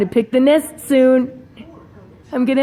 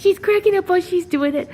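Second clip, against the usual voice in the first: higher repeat; 6 semitones higher, faster than usual. Shown as a demonstration. Owner repeats remark to bird in higher voice. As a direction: say it again, higher and quick. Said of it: "repeats line higher".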